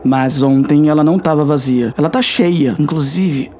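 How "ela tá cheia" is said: usual speaking voice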